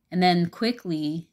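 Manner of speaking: ordinary talk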